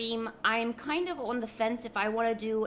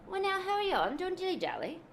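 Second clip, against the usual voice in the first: hammed-up British accent at higher pitch; 8 semitones higher than usual. Streamer ordering Pancake to hurry, in a British accent. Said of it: "I am being British".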